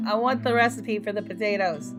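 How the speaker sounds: ordinary talk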